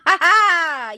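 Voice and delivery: big, high, drawn-out cackle; hammed-up gleeful laugh; loud cackle. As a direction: cackle loudly after the taunt. Mock villain laugh after taunt.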